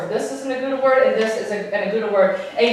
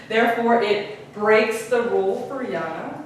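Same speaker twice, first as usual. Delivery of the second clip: usual speaking voice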